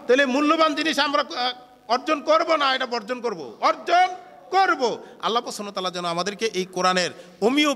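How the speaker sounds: loud, ringing sermon voice to the gathering; fervent Bengali waz sermon, loud and driving; preaching at full force. Acted. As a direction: preach it out to the hall with full conviction. Preaching before the lesson.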